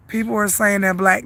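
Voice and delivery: ordinary talk, nothing marked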